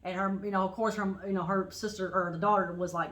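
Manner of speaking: usual speaking voice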